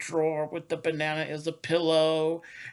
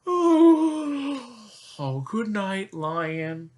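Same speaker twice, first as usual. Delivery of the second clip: zookeeper voice; 6 semitones higher, 6 dB louder, much slower than usual. Pretend. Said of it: "Zookeeper Joe goes".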